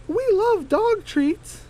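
very high, slow, lazy whine; very high, slow voice complaining it's too much work; high Flower voice. Acted. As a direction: stretch it out way up high.

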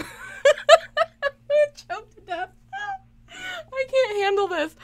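high, slow, wheezing laugh-cry; helpless laughter. Real, not acted. Streamer choking with laughter at creepy bears. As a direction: barely get the words out laughing.

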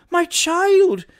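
loud, high-pitched maternal panic; screamed alarm. A narrator's reading. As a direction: scream out for your endangered child. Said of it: "screamed Missus Fleetwood".

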